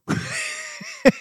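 high-pitched laugh; amused, high laughter. Real, not acted. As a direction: crack up at your own story. Amused at officiant's retold reply.

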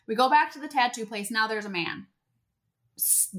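normal talking voice

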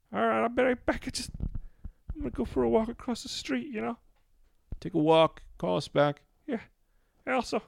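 high, strained little guy voice; squeaky sheepish lizard-man confessing; [name]'s caller voice. Acted.

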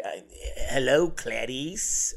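slow, drawn-out creepy greeting; psych ward inmate. Acted. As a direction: greet her like an unhinged inmate, slowly. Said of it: "like, a psych ward with".

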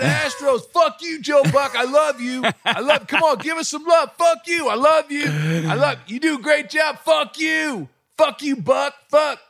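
high, frantic strained yelling; hammed-up unhinged fan screaming love and abuse; complete meltdown. Fan losing it at the announcer, complete screaming meltdown. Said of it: "he had a complete meltdown".

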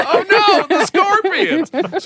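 high mock panic; slow hammed-up dread; mock fear. Mock alarm.

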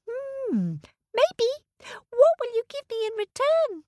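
very high, fast squeaky ant; tiny ant. Acted. The ant bargains.

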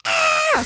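high shouted yelp of shock; short startled shriek; mock scream. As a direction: girlfriend shrieks as the water hits. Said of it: "then she was like".

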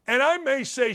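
normal talking voice

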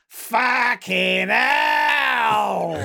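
drawn-out roaring curse; furious shout. Retold or imitated. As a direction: bellow it long and loud. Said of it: "and he goes".

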